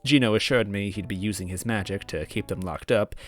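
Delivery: steady first-person storytelling read; calm narrator voice; story narration. A narrator's reading.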